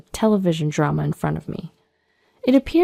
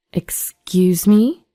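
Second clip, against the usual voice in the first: drawn-out, rising, indignant question; affronted disbelief; 3 dB louder, much slower than usual. Acted. Woman answering ex's jibe about her weight, affronted. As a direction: stretch it out in offended disbelief.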